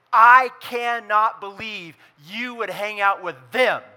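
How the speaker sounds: loud, scandalised exclamation; indignant religious person. Acted. Pastor mocking the religious crowd, loud and indignant. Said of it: "religious people have what I like to call anti-repentance".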